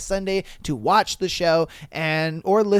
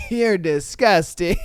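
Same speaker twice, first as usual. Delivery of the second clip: mock disgust; 4 dB louder, slower than usual. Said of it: "she looked at you like".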